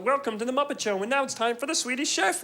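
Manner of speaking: high puppet frog announcer; high cartoon show host; Kermit the Frog. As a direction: announce the act in a high put-on voice. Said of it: "as Kermit the Frog pops up".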